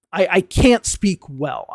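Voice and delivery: ordinary delivery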